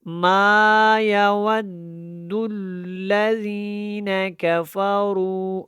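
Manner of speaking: long held vowel on ما, measured chant; firm, slow tajweed demonstration; drawn-out Quran recitation. Shown as a demonstration. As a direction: recite very slowly, stretching the madd in ما. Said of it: "الف مدہ کی وجہ سے لمبا کرنا ہے".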